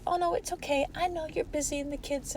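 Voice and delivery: high, soft, sugary fake reassurance; mock placating voice. Mocking the soft coach who excuses her clients, high and hushed. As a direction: coo it like a pushover coach letting them off. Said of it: "If I just placated everything and said".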